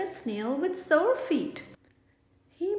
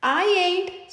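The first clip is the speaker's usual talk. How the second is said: louder, higher snickering refusal; 5 semitones higher, 8 dB louder than usual. Acted. Possum snickers a refusal at gator, louder and higher. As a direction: sneer a cheeky no. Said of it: "snickers Madam Skunk".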